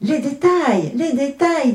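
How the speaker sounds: put-on high, clamouring children; insistent high-pitched kids' clamour; children's demand. A narrator's reading. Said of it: "disent les enfants".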